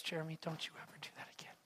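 quick, whispered telling-off; hushed rebuke. Retold or imitated. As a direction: whisper it as the wife's stern warning. Wife quietly rebukes him, retold.

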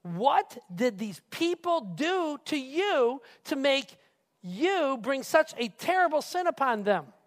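demanding. Acted. Moses confronts the high priest over the golden calf, demanding. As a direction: confront him, drawing out each accusing word. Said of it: "and he, and he demands".

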